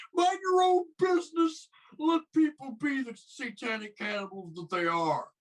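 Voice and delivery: very high, loud mocking doctor voice; scolding doc. Doc scolds Billy for exposing his parents, high mocking falsetto. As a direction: scold him in a shrieking put-on falsetto. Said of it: "the doc is scolding him for".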